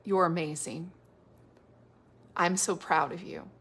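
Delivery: soft, low and gently spaced; murmured tenderly, with long pauses between phrases; tender inner voice. Acted. God's quiet voice to a woman at the mirror, soft and tender. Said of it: "it says things like".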